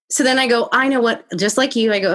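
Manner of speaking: ordinary delivery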